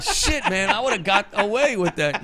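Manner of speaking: squeaky exasperated mock cry; mock exclamation. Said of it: "I'm like".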